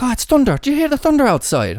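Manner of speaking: put-on over-the-top Irish lady accent cracking into laughs; terrible Irish accent. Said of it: "I know that it's bad".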